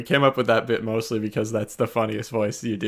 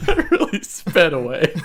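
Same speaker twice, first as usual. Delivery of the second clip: laughing; 6 semitones higher, 4 dB louder, slower than usual. Real, not acted. Cracking up at own bit.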